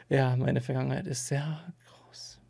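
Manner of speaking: hushed whisper. Real, not acted. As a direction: whisper it like a secret. Whispered confession about his past.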